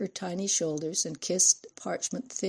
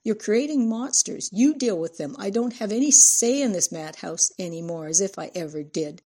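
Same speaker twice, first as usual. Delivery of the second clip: loud, raised-pitch accusation; exasperated rant while leaving the room; angry, louder outburst at husband; shouting; 4 semitones higher, 6 dB louder than usual. A narrator's reading.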